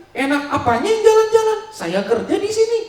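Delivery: high, loud indignant shout; wife angry again. Acted. Wife defending her work in Dubai, loud indignant yelling. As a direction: throw his words back in his face. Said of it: "Istrinya marah lagi".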